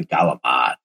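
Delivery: galamad said the South Philly way, hard g and dropped ending; South Philly Italian. Shown as a demonstration. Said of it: "add in a little bit of, uh, the Italian in it".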